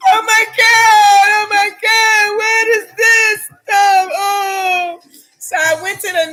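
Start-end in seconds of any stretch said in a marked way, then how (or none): 0.1-5.0 high, loud, slow mock wail; horrified wailing. Woman retells landing in mystery water up past her knee, horrified wailing. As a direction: re-enact a panicked, drawn-out cry of disgust. Retold horror at standing in water.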